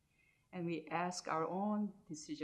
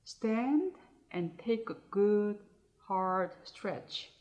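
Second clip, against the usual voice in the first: guided meditation; 2 semitones higher, 7 dB louder than usual. Acted. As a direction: guide listeners phrase by phrase, unhurried, more projected than chatting.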